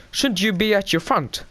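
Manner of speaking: high-pitched imitation of a questioning master; mock incredulous question. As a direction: question it high, acting out a scene. Obi-Wan questions Anakin in retelling.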